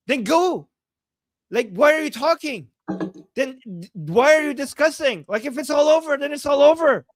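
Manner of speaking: half-acted indignant outburst; mock exasperated rant. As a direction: rant at the commenters, fed up. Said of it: "He's saying, "How pathetic".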